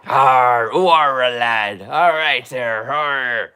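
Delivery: low, slow pirate "Arr" with Yorkshire; pirate talk. Retold or imitated. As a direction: growl it out like a pirate. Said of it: "It's all the pirate talk".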